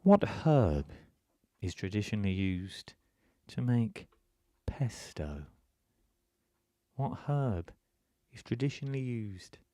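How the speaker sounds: lightly put-on MasterChef announcer, portentous pauses. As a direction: draw out the question in short, dramatic chunks. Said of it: "oh, I'll do my MasterChef voice".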